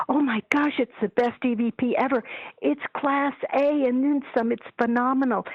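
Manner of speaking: high-pitched, gushing raves. Retold or imitated. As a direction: imitate someone overawed, pitched well up. Retelling friend's gushing over recording.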